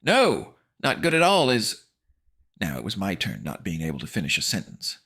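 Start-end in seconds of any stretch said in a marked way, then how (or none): 0.0-1.8 high, loud and cut short; pitched-up cry; alarmed refusal. A narrator's reading. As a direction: blurt it out in alarm. Frightened reply, cut off.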